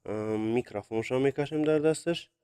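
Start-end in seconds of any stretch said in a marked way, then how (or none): none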